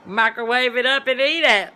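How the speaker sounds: high, loud, laughing mock cashier; imitating the cashier. Imitating the drive-thru girl pushing the microwave idea, loud and high. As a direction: ham up the cheery worker's advice, loud and high. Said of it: "your girl does".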